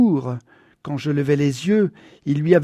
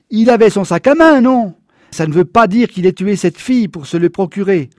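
heated; 4 semitones higher, 7 dB louder than usual. A narrator's reading. Quarrel with father.

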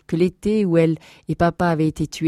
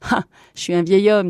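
louder, higher puffed exclamation; panting; 4 semitones higher, 5 dB louder than usual. Acted. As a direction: gasp it after losing a race. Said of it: "dit le capitaine Pantelan".

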